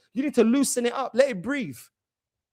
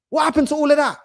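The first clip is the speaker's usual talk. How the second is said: usual speaking voice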